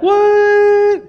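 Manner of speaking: very high, loud, long-held mock cry; mock astonishment. Tennessee local hearing Minnesota, in hammed-up loud astonishment. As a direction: stretch it into a hammed-up squeal of disbelief.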